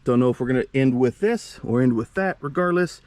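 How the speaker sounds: ordinary delivery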